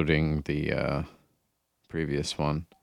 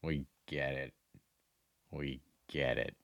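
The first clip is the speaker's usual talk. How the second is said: fading repeat; 4 semitones lower than usual. Pretend. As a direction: say it again and again, letting it die away. Repeats line, fading away.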